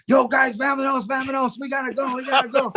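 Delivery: storming in to get everyone out; mock urgency. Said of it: "he comes storming in the dressing room".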